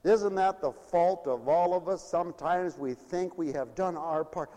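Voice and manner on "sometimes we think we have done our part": normal talking voice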